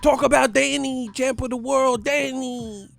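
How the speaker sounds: high-pitched hyped-up holler; Angel going off. Acted. Imitating Angel hyping up a champion fighter, hyphy high holler. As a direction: mimic Angel yelling his hype, high and loud. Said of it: "I like when Angel go off like that".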